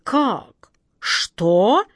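startled sputter; jolted, indignant outburst; mock royal shock; shocked king. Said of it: "побагровел король".

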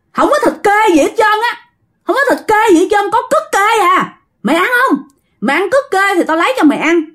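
high-pitched, defiant scolding; stubborn mother cursing back at a spirit; scolding retort. A narrator's reading. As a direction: throw the refusal back, high and scornful. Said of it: "Bà cố chửi vậy đó".

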